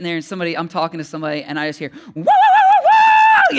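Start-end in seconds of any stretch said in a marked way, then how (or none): 2.1-3.6 mock wail, very high and very loud; hammed-up high wailing yell; Pentecostal yelling. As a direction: imitate a woman yelling in the Spirit at full force. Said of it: "yelling, like, Pentecostal yelling".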